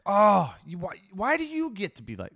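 high, loud exasperated outburst at another driver; irritated driver. Retold or imitated. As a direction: vent at the car ahead. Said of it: "find myself going like".

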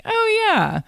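high, bright imitation of mom. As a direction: squeak it out like mom. Mom's reply, voiced high.

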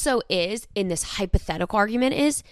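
usual speaking voice

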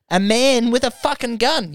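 incredulous. Retold or imitated. Retelling his shocked reaction.

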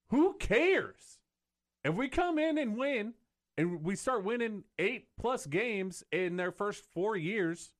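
fired-up high rant. Real, not acted. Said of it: "go on my rant".

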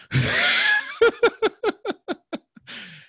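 high, loud burst of laughter; long high laugh, tapering off; laugh of release. Real, not acted. Release after emotional breakthrough.

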